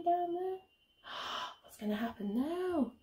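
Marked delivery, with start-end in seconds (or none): none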